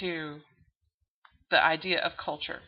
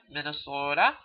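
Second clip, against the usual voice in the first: mock Minnesotan stretching "Minnesota"; bad Minnesota accent; 5 semitones lower than usual. Lecturer mimicking how Minnesotans say "Minnesota", bad Minnesota accent. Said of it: "I do a bad accent".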